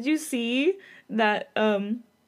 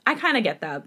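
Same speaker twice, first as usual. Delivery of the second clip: usual speaking voice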